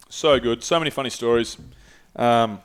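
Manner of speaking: ordinary talk, nothing marked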